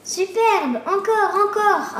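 high, delighted cheer; jubilant. A narrator's reading. Boy applauding the witches' insult duel, jubilant. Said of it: "applaudit Pierre en jubilant".

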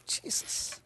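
soft unvoiced whisper. Real, not acted. Preacher whispering the name to the congregation.

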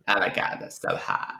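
low, creaky imitated fry; mock LA vocal-fry voice. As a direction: drop soft and low into LA fry. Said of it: "[name]'s vocal fry is fantastic".

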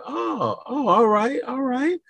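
officer accepting a dare, high mock approval; amused officer. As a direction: savor the challenge. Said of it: "He said".

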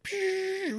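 imitated high-pitched teapot whistle; high kettle hiss. Man imitating steam heard after breakfast, high kettle hiss. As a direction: make a thin, high steam noise. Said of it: "really high, like ... like a teapot".

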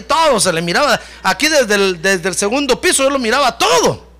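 lively mock-scolding, high at the end; mocking Mical. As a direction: imitate a scornful wife deriding the king. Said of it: "se quiso burlar de, de David". (burla exagerada, imitando un reproche)

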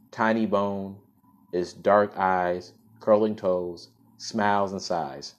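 clear, fuller storytelling voice; story narration. Acted. Host reads the story to listeners, in a storybook narration. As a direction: read it out like a storybook.

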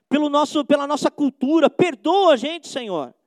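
loud, high pleading prayer; fervent pulpit prayer. Acted. Preacher praying aloud for the nation's sins, loud and pleading. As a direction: beg forgiveness for the nation, full voice.

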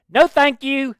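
high, loud, rough stab at Scottish; attempted Scottish accent. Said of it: "in the Scottish accent".

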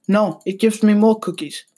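usual speaking voice

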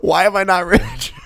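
high, loud mock of a friend's line; mocking quote. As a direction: throw the line back, incredulous and amused. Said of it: "And then you went with".

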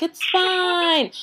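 carefree local voice. Retold or imitated. Voicing relaxed Argentinians.

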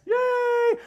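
high-pitched mock squeal of delight; excited cheer. Imitating travelers cheering on reaching Buc-ee's, in a high squeal. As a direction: hold out a high, playful cheer.

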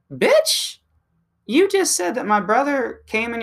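a sudden squealing high burst of outrage; high yelp. Acted. Comic yelling back at the woman who taunted him, high outraged yelp. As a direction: snap back with one shrill shocked word.

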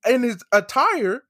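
high-pitched, disbelieving recollection; incredulous retelling. Retold or imitated. Retells disbelief at wrestler's true origin.

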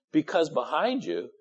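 ordinary talk, nothing marked

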